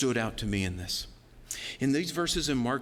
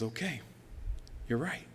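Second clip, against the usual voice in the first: softer, humbled concession; subdued admission; 2 semitones lower, 7 dB quieter than usual. Retold or imitated. Preacher answering Jesus's rebuke, subdued admission. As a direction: concede it softly.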